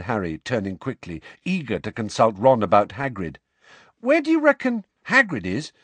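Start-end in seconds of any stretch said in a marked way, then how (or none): 4.0-5.7 put-on high questioning boy voice; Harry's voice. Harry asks Ron, worried.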